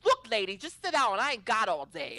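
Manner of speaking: put-on brash kid with a Boston accent. Cute kid dismissing opponent, brash Boston accent. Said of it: "he had, like, some kind of Boston accent".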